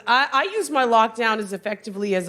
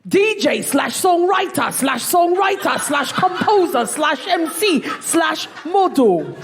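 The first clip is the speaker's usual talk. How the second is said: put-on London accent, pitched up; guessed London accent; 7 semitones higher than usual. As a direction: read it out proudly in a broad London accent. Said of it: "guess what his accent is".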